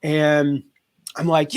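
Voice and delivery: ordinary delivery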